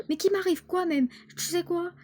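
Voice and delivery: high, sharp indignant retort. Acted. Daughter snaps back at her overprotective mother, indignant. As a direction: throw it back at her, outraged.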